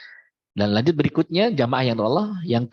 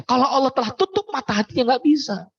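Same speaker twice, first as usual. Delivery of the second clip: raised warning; 12 semitones higher than usual. Real, not acted. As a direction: drive the warning home, pitched up high. Preacher stressing a warning.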